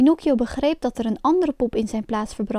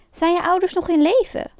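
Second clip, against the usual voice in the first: higher-pitched astonished asking; surprised question; 6 semitones higher than usual. A narrator's reading. Puppet master hears the boy call father, surprised. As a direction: ask it in mild amazement, pitch raised. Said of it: "vroeg hij verbaasd".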